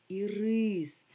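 «ірис» with the second syllable stressed, long and loud. Shown as a demonstration. Teacher naming the caramel candy, «ірис» stressed on the last syllable. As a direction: hit the РИС in «ірис». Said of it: "тоді ми кажемо".

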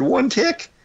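you can't be serious, high and sharp; incredulous. Retold or imitated. Retelling his shock at a one-tick stop, incredulous high. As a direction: pitch jumps way up in disbelief.

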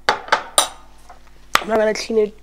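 talking through a hot, spicy mouthful; words slowed round burning food; mouth full of hot food. Real, not acted. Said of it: "Oh, my mouth is on fire".

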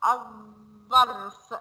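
الظرف very slow, the lam silent, az-zarf with a doubled z; slow sun-letter demo. Shown as a demonstration. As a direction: drag out الظرف swallowing the l into the next sound. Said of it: "بصوا نطقناها ازاي؟".

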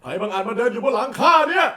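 high, shouted Monkey King challenge; Sun Wukong. Shown as a demonstration. Voice actor auditions for the hosts, outraged Monkey King shout. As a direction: bellow indignantly as a legendary monkey warrior. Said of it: "อยากพากย์หงอคง".

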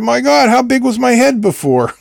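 high-pitched punchline, laughing; amused retold exclamation. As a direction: exclaim it in mock shock, breaking into laughter. Retelling a favourite comedian's punchline.

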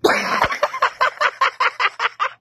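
high cackling laugh; troll cackle. Pretend. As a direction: cackle like a prankster. Trolling strangers in voice chat.

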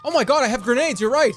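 high, loud thrilled outburst; excited. Real, not acted. Discovers he has grenades.

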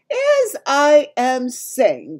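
loud, high, drawn-out giant voice; insistent giant. A narrator's reading. Giant resuming his lecture to Sophie, loud and slow. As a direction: stretch each word out big and loud.

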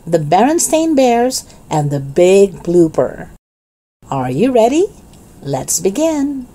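ordinary delivery, nothing marked

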